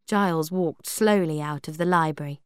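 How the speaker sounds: plain reading voice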